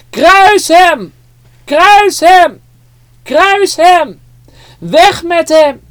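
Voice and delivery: high, loud chanting yell; mob shouting. Acted. Crowd chanting at Pilate to crucify Jesus, high and loud. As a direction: roar it like a furious crowd. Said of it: "het antwoord wordt steeds harder geroepen".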